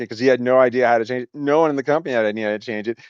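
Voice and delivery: ordinary talk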